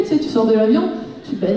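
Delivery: ordinary talk, nothing marked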